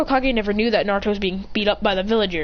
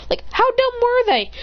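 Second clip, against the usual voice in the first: much higher incredulous outburst; fed up with the characters' stupidity; exasperated; 12 semitones higher than usual. Real, not acted. Ranting about Death Note plot.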